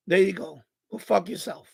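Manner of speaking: normal talking voice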